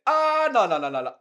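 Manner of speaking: loud high cry dropping into repeated protest; panicked outcry. Real, not acted. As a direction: cry out in alarm, then tumble down. Panics over the pizza.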